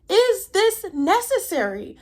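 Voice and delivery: very high-pitched mock disbelief; exasperated question. Viewer reacting to a ball of chaos, mock exasperated.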